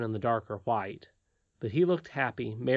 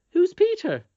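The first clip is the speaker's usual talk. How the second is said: high, loud, sniffy nurse; a haughty nurse snapping back; prim nurse voice; 9 dB louder than usual. Acted.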